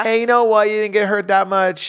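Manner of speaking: high, loud brush-off; mock dismissive parent. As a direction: make light of a kid's fall. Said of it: "if someone takes a fall".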